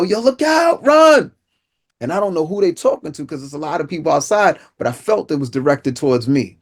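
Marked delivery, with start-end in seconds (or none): 0.0-1.3 high, loud warning shout, much higher than his usual pitch; retold yell. A bystander warns him about the gunman, loud shout. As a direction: shout a sudden warning across the street. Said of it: "and they just yell".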